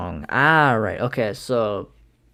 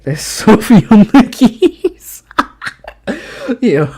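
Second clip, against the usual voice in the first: laughing; 13 semitones higher, 13 dB louder, slower than usual. Real, not acted. Laughing at the clip.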